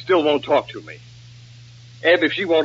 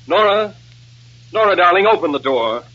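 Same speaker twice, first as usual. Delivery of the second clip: raised, pleading call; 2 semitones higher, 4 dB louder than usual. Acted. Nicky outside Nora's dressing room, urgent calling. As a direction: call through the closed door.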